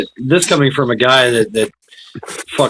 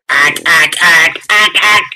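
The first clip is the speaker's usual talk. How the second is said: rising alien ack ack; Mars Attacks alien; 4 semitones higher, 4 dB louder than usual. Retold or imitated. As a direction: squawk like a Martian. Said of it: "sound like the alien from Mars Attacks".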